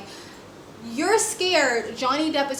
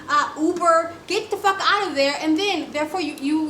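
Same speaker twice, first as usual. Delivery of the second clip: normal talking voice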